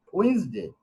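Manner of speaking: ওয়েডনেসডে said as wins-day, silent d; correct pronunciation. Shown as a demonstration. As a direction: repeat ওয়েডনেসডে in two syllables, "uins-de". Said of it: "উচ্চারণটা".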